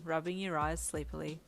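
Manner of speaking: ordinary delivery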